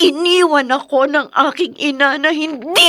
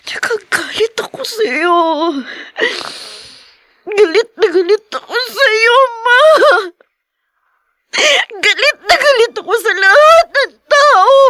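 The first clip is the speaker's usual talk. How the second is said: building from tearful to loud sobbing anger; crying fury that keeps growing; angry crying; 5 semitones higher, 4 dB louder than usual. Acted. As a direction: let the rage rise through the tears. Daughter raging at her mother.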